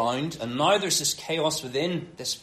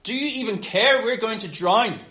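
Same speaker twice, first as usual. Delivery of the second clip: raised, louder cry of alarm; alarmed disciples; 4 semitones higher, 4 dB louder than usual. Acted. Disciples waking Jesus as the boat sinks, alarmed. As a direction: wake him up in a panic, voice pitched up. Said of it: "they wake him, they go".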